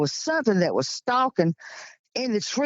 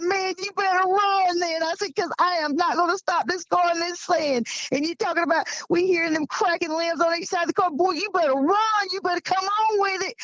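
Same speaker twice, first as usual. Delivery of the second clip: telling a scared friend to run, frantic and high; frantic urging; 11 semitones higher than usual. Retold or imitated. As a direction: urge him on like you're scared to stop.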